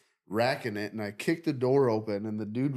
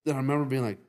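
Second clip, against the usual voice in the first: usual speaking voice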